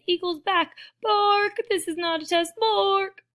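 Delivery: loud, high barked warning; watchdog alarm. Acted. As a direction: bark it like a siren.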